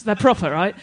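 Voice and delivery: ordinary talk, nothing marked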